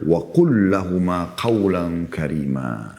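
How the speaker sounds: slow, measured Arabic recitation; slow Quranic recitation. Shown as a demonstration. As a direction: recite the verse slowly and reverently. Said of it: "Dan Allah bilang perintahnya".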